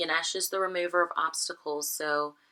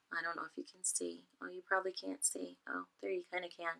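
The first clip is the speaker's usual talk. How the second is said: hushed, quick aside while checking; quiet aside; 12 dB quieter, faster than usual. Real, not acted. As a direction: drop to a fast, very soft self-directed murmur. Checking if viewers can see card.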